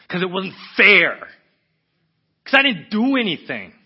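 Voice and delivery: loud, high cry of protest; raging outburst. Retold or imitated. Man protesting to God that it wasn't fair, raging shout. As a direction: explode with hurt indignation. Acting out raw rage at unfairness.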